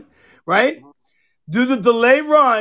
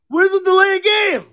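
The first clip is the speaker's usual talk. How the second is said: much higher, indignant call-out; retold yell at ref; 9 semitones higher than usual. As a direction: throw it at the ref like an accusation. Yelling at the ref.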